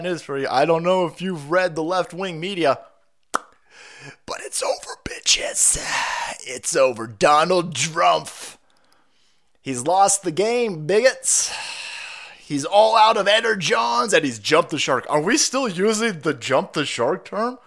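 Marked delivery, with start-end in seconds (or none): 3.3-5.8 slow, unvoiced taunt in a hushed whisper; mock gloating whisper. Acts out gloating left-wing media. 6.4-14.1 mock gloating. Acts out gloating left-wing media.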